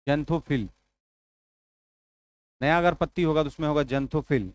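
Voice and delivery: normal talking voice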